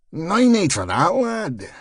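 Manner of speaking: put-on a Belfast boy calming the bouncer down; Belfast accent. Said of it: "continuing his Belfast boy act".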